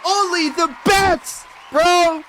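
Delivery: loud, high, drawn-out hype call; high shouted praise for the doll. Real, not acted.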